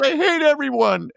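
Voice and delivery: high-pitched mock tantrum; imitated upset boy, very high; upset kid. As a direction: wail it like an upset child. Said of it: "he's all upset".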